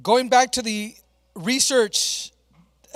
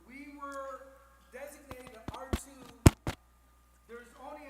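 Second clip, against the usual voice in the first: talking off mic; 2 semitones higher than usual. Real, not acted. Presenter restarting after mic trouble, talking off mic. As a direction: address the room without the microphone. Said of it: "let's just talk like this".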